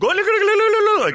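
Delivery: very high, loud squeaky mock; high squeaky mock look look. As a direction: squeal it like a cartoon. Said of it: "or go".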